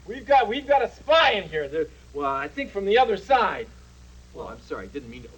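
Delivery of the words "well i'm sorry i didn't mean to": sheepish apology. Acted. Embarrassed apology after the outburst.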